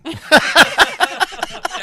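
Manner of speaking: high laugh; real laughter; big burst of laughter. Real, not acted. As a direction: laugh out loud. Laughing at own story.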